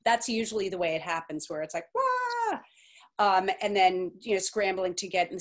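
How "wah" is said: sudden high, drawn-out "wah"; comic high-pitched cry of being swamped; mock panicked wail. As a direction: let out a held, high cartoon wail of overwhelm. Imitates panic at a sudden workload.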